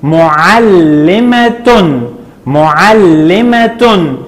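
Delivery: معلمة very slowly, ending in "-tun"; tanween damm ending. Shown as a demonstration. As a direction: stretch معلمة syllable by syllable into "mu-al-li-ma-tun". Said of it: "عند التنوين بالضم هنقول".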